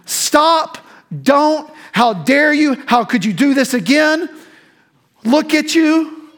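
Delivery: loud, high scolding, slow on look at you; mock scolding. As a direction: scold like a disappointed parent. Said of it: "we think that it's gonna be".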